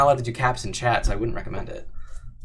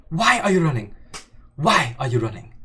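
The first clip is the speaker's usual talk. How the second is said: louder mock question; 3 semitones higher, 4 dB louder than usual. Repeats the question, escalating.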